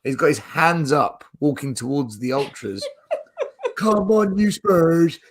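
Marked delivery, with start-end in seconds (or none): 3.8-5.2 high-pitched mock English football chant; fake English accent. Imitating his friend walking towards the ultras, fake English accent. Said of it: "His fake accent".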